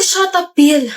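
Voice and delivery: irritated repeat of shut up; snapping repeat. Acted. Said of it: "I said".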